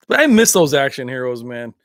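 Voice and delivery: ordinary delivery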